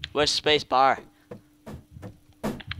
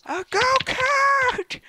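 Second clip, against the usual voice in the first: much higher, slow and drawn out; delighted squeal; much slower than usual. Real, not acted.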